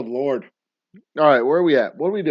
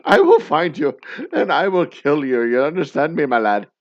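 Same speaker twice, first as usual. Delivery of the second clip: loud, hammed-up Irish threat; bad Irish accent; 8 dB louder than usual. Father threatens his daughter's kidnapper, in a bad Irish accent. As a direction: threaten in a forceful mock-Irish brogue. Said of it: "affect a bad Irish accent".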